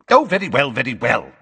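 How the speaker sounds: loud, irritated concession; snapping. Acted. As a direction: give in with a sharp snap. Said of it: "snapped Fudge".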